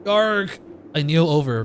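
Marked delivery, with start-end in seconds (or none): 0.0-0.6 sharp cry of pain. Acted. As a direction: gasp of sudden pain. Sudden stabbing pain.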